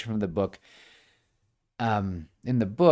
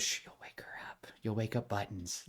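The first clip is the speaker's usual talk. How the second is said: teasing whisper, much softer; 11 dB quieter than usual. Retold or imitated. Other Coraline warning Coraline over the sleeping baby, whispered. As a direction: whisper so the baby stays asleep.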